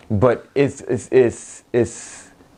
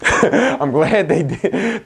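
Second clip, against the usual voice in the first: laughed out, high and very slow; laughing; 10 semitones higher, much slower than usual. Real, not acted. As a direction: laugh through the words. Laughing at his own genocide joke.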